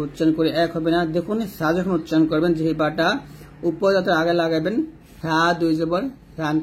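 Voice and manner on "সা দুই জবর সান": সা and সান as a thin "th", tongue at the upper teeth; light th sound in সা; thin lisped tha. Shown as a demonstration. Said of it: "পাতলা করে বলবেন".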